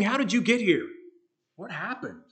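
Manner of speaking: high-pitched puzzled question; surprised questioning. Retold or imitated. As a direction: act out an astonished crowd asking. Acting the crowd's surprised question.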